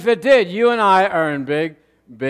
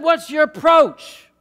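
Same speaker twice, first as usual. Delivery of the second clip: incredulous question; 6 semitones higher, 3 dB louder, slower than usual. Retold or imitated. Pastor questions hitchhiker's method.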